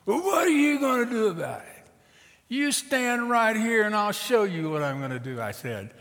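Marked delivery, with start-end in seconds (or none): 0.0-1.9 high, loud taunting challenge; belligerent father. Acted. Papa Bear dares the preacher, belligerent. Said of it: "comes up and chest bumps me". 2.5-5.4 high, forceful counter-challenge; bold bluff. Acted. Preacher bluffs back at Papa Bear, defiant.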